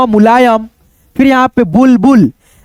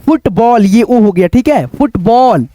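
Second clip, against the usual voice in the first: usual speaking voice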